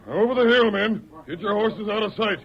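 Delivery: outlaw boss shouting orders; shouted order. Acted. Gang boss ordering his men.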